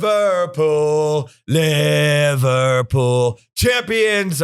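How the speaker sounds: very slow, stretched-out ring announcer call; hammed-up WWE announcer. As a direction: drag the name out like a wrestling intro. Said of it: "I'm doing my W W E voice".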